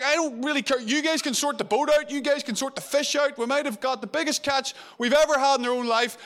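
excitedly brushing off the boat and fish; eager Peter. Retold or imitated. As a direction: Peter can't wait to reach Jesus. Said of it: "And he's like".

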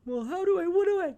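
high squeaky confused voice; mock a flustered customer; high mocking bewildered squeak; confused client.

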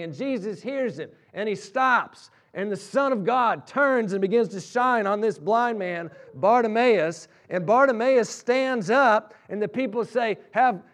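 fervent preacher building a story to its peak; impassioned preaching. Real, not acted. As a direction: preach it at full force, driving each phrase home. Preaches story's climax at full force.

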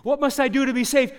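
put-on young man begs the preacher, loud and high; loud pleading cry. Seeker pleads again with preacher.

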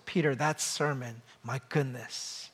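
soft, low, awed praise; hushed mock compliment. Churchgoer praising the preacher's sermon, soft and hushed. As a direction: murmur it like an admiring churchgoer.

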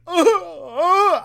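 high, loud mock sobs; imitated sobbing. Reader overcome by the last page, imitating loud sobs. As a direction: burst into sobs on the train, hammed up. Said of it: "started bawling and crying involuntarily".

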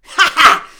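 loud wicked hag laugh; hag cackle. A narrator's reading. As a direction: cackle like a gleeful old hag. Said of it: "laughed the old hag".

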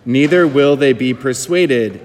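slow, solemn scripture reading; Gospel reading. Acted. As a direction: read it like a lector at Mass.

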